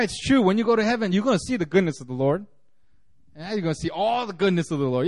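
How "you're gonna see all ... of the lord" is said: ordinary talk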